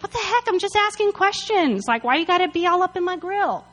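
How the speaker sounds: high-pitched, whiny comeback; indignant protest. Retold or imitated. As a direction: protest like a picked-on kid brother. David snaps back at brothers.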